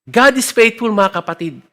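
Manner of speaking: usual speaking voice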